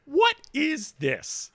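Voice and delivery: high, drawn-out incredulous question; mock disbelief. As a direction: stretch it out high, playing utterly baffled. Mock bafflement at the email.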